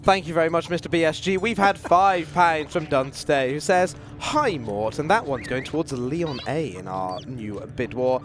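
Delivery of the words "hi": normal talking voice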